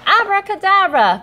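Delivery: high, loud grand incantation; magic spell. Acted. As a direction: call out the spell with flourish. Keith waves his wand.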